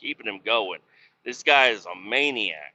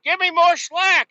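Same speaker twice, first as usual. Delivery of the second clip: high-pitched holler for help from below; mock shouted demand; 7 dB louder than usual. Worker calls up from over here in the pit, mock shouted demand. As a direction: imitate a worker yelling up, high and loud.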